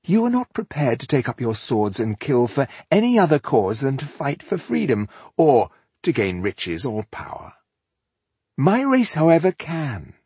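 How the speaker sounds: grandiose elf monologue with high, swooping peaks; glorify your own race to the humans; haughty elf. Acted.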